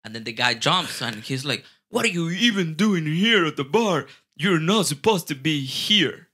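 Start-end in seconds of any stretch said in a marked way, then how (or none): 1.9-6.3 imitating an indignant man, higher-pitched; mock aggressive telling-off; retold confrontation. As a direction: challenge them as the offended guy.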